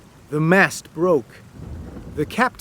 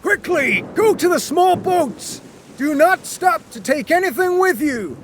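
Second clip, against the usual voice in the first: urgent orders; 13 semitones higher than usual. A narrator's reading. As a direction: hurry everyone to the boats. Captain orders passengers into boats.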